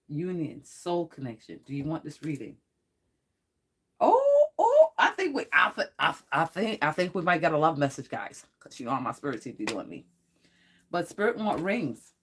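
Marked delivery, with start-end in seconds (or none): 4.0-8.1 sudden much higher, louder gasp of delight, then stammering; overjoyed she spots a love message; thrilled squeal, tripping over words; excited squeal. Real, not acted. Excited by a card drawn.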